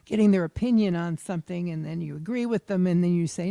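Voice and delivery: usual speaking voice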